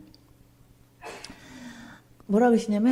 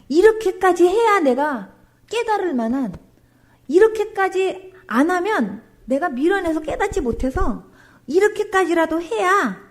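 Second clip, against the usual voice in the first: higher, louder, slow pleading; earnest pleading; 6 semitones higher, 4 dB louder than usual. Retold or imitated. A woman pleading loudly with God in prayer. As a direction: beg God with rising urgency.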